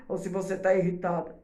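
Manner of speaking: mildly irritated as a demo. Shown as a demonstration. Teacher showing mothers an irritated tone, mildly annoyed. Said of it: "ela vai entender o tom de voz".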